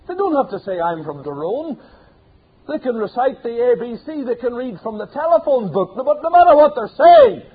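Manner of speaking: a hellfire preacher hitting his peak; preaching at full force. Acted. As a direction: thunder it at the congregation.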